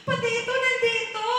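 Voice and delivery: loud, high exclamation of disbelief; acted shock.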